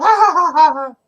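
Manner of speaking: high, loud mocking ha ha ha; mock eagle laughing at the snake; hammed-up eagle laugh. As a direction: cackle up high at the stranded snake. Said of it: "And so the eagle laughed".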